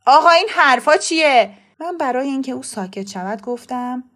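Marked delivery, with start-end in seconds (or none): 0.0-1.5 angry, higher-pitched outburst; raised voice, exasperated protest; loud voice. A narrator's reading. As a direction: burst out loudly in indignation. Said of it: "با صدای بلند گفت".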